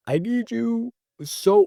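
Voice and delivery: high, slow, aching plea; pleading prayer. Retold or imitated. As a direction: stretch it out like a desperate prayer. Praying to God in church.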